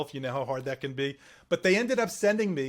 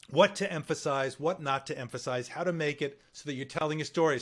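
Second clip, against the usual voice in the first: normal talking voice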